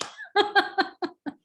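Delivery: high-pitched amused laugh; high giggling laugh; real laughter. Real, not acted. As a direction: crack up laughing at a joke. Cracks up at frog joke.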